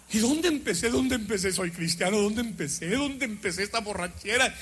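put-on low, bewildered drunk voice; dreaming he's drunk, low and dazed; mock drunken confusion. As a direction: slur it like a lost drunk.

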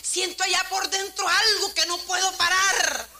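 high-pitched mock crying voice, slowing at the end; imitating tearful man. Weeping stranger explains himself to the singer, imitated high tearful voice. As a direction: act out a man unable to stop crying. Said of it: "usted por qué está llorando".

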